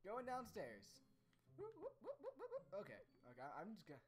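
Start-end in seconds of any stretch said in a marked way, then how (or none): 1.6-2.6 playful whooping. Pretend. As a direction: run of high-pitched whoops. Imitated noise while going downstairs.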